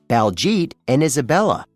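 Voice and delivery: usual speaking voice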